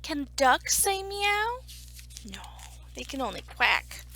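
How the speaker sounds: ordinary delivery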